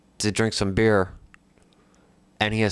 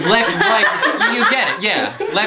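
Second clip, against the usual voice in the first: laughing while speaking; 10 semitones higher, 6 dB louder, slower than usual. Real, not acted. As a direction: blurt it out through laughter. Laughing through confused directions.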